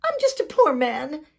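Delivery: high, pleading little voice; a hint of a whimpering leprechaun; whining. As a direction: whine to get free. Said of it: "wiggled and twisted and whined".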